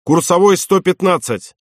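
angry officer's order, narrated with some restraint; angry call. A narrator's reading. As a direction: call the bearing out crossly. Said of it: "зло крикнул он".

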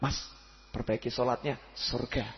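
low, soft kindly urging; a kind believer gently urging a man to pray; gentle advice. Retold or imitated. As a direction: coax him softly toward prayer. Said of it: "Yang satu".

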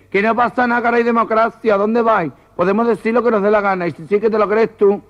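high-pitched protest leader's reassurance; comic high voice, protesting; acted organiser. Protest organiser calls back fleeing crowd.

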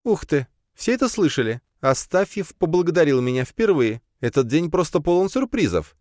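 high, teasing mock surprise; mocking exclamation. A narrator's reading. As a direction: tease him with fake amazement. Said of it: "с издёвкой воскликнула Надя".

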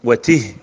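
وته said "wa-tih", ending on a breathed h; silent-vowel final h. Shown as a demonstration. Teacher listing feminine demonstratives, وته ending in a vowelless h. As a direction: close وته on h with no vowel after it. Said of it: "بسكون الهاء".

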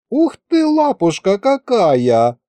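very high, adoring coo; high-pitched, drawn-out doting squeal. A narrator's reading. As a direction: gush over something adorable. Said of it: "умилилась Маша".

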